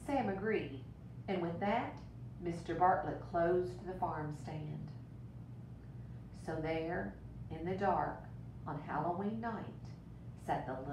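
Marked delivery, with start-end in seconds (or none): none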